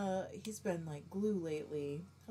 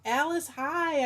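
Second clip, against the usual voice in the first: bright, louder, drawn-out hello; light up as you greet her; happy, loud welcome to a friend; excited greeting; 4 semitones higher, 7 dB louder, slower than usual. Real, not acted. Greeting a viewer by name.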